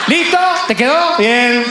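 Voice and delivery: high, loud, cocky closing jab; smug comeback. Acted. Fisherman rubs it in to the man on shore, cocky high jab. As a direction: finish the put-down with a grin. Fisherman snaps back at stranger.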